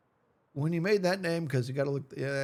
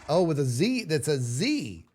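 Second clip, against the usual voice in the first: normal talking voice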